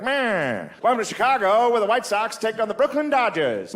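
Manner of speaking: high-pitched vintage broadcast voice; old-time radio announcer. Acted. Announcers welcoming listeners to the game, vintage radio voice. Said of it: "You can hear the announcers".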